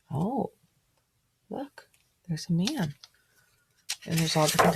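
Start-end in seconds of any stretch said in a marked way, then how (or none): none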